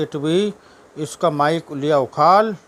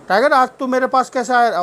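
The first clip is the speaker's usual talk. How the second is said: playful higher voice to the dog; high pet talk; 8 semitones higher, 4 dB louder than usual. Real, not acted. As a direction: ask the dog sweetly, pitched up. (कुत्ते से ऊँची, लाड़ भरी आवाज़ में बात)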